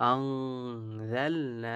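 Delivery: আনজালনা with the ন hummed nasally, slow; nasal ghunnah demo. Shown as a demonstration. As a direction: stretch আন through the nose. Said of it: "নুন সাকিনকে গুণা করবো". (আনজালনা তে গুন্নাহ)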